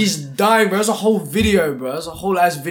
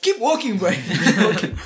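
laughing; 6 semitones higher than usual. Retold or imitated. Telling stoned friend to move on.

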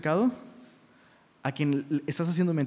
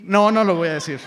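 loud, laughing change of mind; playful loud refusal; 7 dB louder than usual. Pretend. Pastor playfully backing out of saying it, loudly refusing. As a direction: burst out with a mock-firm no. Mock refusal after wavering.

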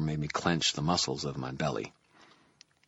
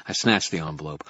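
usual speaking voice

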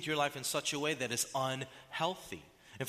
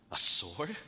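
incredulous question; 5 semitones lower, 4 dB louder than usual. Real, not acted. Repeating Jesus' word in disbelief.

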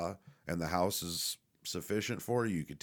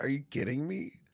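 repeating the question through a laugh, much higher; laughing disbelief; 8 semitones higher than usual. Retold or imitated. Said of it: "laughs You know, like".

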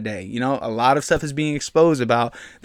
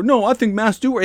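higher, louder whiny mock voice; mock critic; 8 semitones higher, 4 dB louder than usual. Mocking a critic who defends masks, whiny. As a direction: mimic a mask believer. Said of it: "people that are gonna be offended".

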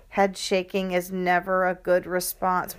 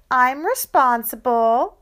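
smug pleading; 6 semitones higher, 7 dB louder than usual. Acted. Sister mimicking brother's claim.

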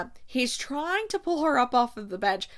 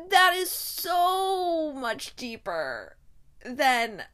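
drawn-out, high, loud raving; gushing; 4 semitones higher, 5 dB louder, much slower than usual. Real, not acted. Reacting to spectacular writing, real gushing excitement. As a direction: gush with emphatic, stretched words. Gushing over passage just read.

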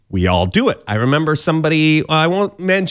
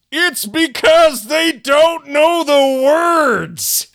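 punchline shout; 10 semitones higher, much slower than usual. Acted. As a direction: belt out the joke slowly. Punchline joke.

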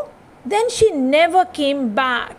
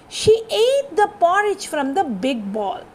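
ordinary delivery

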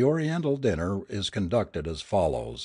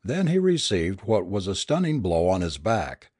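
ordinary delivery

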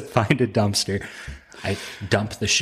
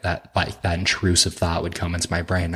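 ordinary delivery

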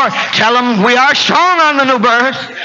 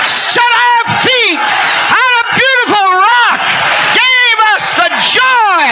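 preaching at full force; 11 semitones higher than usual. Acted. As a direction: whoop it out to the rafters. Preaching climax, chanted.

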